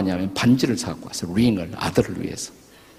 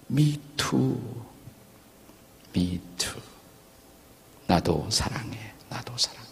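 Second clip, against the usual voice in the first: softer and softer whispered last words; faint whisper; 8 dB quieter, slower than usual. Retold or imitated. Dying writer whispering back to his wife, fading. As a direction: fade into a breathy hush like a dying man.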